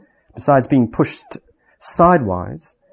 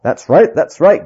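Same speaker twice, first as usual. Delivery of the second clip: growling dwarfs; 5 semitones higher, 6 dB louder than usual. Acted. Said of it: "growled the other dwarfs".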